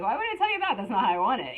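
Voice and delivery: retold exasperated comeback; indignant retort. Quoting herself answering the helper, mock indignant. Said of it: "And I'm like".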